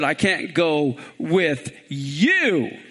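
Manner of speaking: slow, low line with "you" stretched long; measured pace, all the weight on "you"; stress on YOU. Shown as a demonstration. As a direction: draw out "you" heavily at the end. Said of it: "it's very important. At first, when he said".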